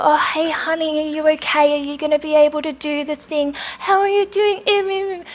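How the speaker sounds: high, soft, sugary talking-down voice; mock baby talk. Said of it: "Baby talk is just like".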